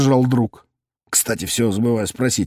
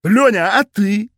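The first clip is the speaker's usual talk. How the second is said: growled; 8 semitones higher than usual. A narrator's reading. Cop growls at drunk Lyonya, mock menace. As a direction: growl the demand at him. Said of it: "зарычал я".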